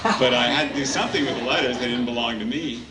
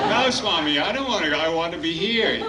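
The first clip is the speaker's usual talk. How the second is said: put-on higher, laughing plea of a boy; pleading boy; 9 semitones higher than usual. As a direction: lightly voice a boy begging to stay.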